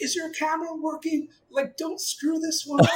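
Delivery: high-pitched frantic yell at a partner; retold excited yelling. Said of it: "I'm, like, yelling at my partner".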